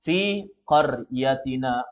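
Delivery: fi qaryatina spelled out slowly, syllable by syllable; teacher modelling it very slowly; slow careful model. Shown as a demonstration. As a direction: say fi qaryatina slowly with no doubled letter. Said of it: "tidak perlu pakai tasdid".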